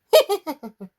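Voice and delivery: high, loud playful giggle; flirty laugh. Acted. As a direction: laugh like you mean mischief. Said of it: "She had such a great laugh".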